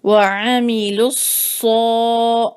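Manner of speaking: وعمیلو سو drawn out very slowly, long heavy سو; slow recitation. Shown as a demonstration. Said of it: "تو اس کو ہم تھوڑا لمبا کریں گے". (وعمیلو سو بہت آہستہ تلاوت)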